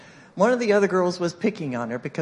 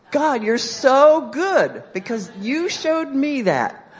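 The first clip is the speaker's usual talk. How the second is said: high, loud, slow outpouring of gratitude; elated praise; 8 semitones higher, 7 dB louder, much slower than usual. Retold or imitated. Speaker thanking God after overhearing the girl, elated. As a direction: exclaim it with drawn-out emphasis on SO GOOD. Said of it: "I'm like".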